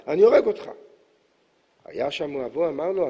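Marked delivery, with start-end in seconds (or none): 0.1-0.7 higher, louder furious shout; father shouting. Acted. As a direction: yell a threat at the son. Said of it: "התחיל צועק ואמר". (אב צועק בזעם על בנו)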